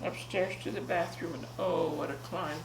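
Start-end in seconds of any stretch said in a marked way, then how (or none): none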